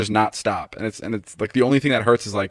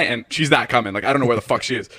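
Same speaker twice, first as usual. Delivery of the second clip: ordinary delivery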